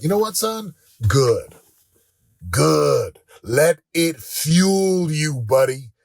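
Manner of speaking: loud, drawn-out, emphatic pep talk; loud fatherly urging. Retold or imitated. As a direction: punch each word slowly to fire up your son. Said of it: "I'm talking loud in the car".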